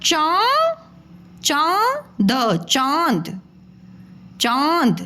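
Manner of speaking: चा then चांद drawn out very slowly with a nasal vowel; building चांद. Shown as a demonstration. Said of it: "उसको क्या बोलेंगे बेटा".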